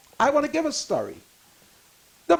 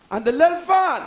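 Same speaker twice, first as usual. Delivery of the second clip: normal talking voice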